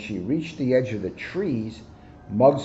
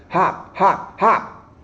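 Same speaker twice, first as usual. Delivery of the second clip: high, louder bouncy hop calls; encouraging calls; 7 semitones higher, 5 dB louder, much slower than usual. A narrator's reading. Rabbit calls encouragement while hopping. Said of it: "calling words of encouragement".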